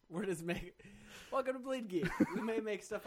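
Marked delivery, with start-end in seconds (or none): 1.3-2.1 high, loud, through laughter; laughing announcement. Real, not acted. Co-host owning up to made-up facts, laughing loudly. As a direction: proclaim it while cracking up.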